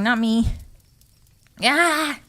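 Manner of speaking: normal talking voice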